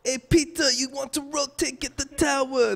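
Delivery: put-on Peter's Rastafarian accent. Player mimics his coach's in-game call, high Rastafarian accent. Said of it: "sometimes I do it in his accent too".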